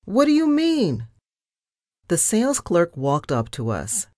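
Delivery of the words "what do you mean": high-pitched indignant demand; affronted squawk of a question; insulted. A narrator's reading. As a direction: protest in an offended, much higher voice.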